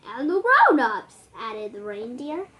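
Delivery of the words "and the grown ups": high, loud teasing add-on; loud quip. Acted. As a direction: toss it in loudly for a laugh. Reindeer adds joke.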